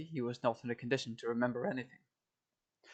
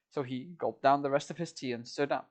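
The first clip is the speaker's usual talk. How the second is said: ordinary delivery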